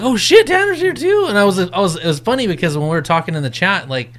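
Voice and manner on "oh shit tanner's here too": much higher surprised exclamation; high mock-shocked oh shit; surprised discovery. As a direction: realize it with a jolt. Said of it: "I was like".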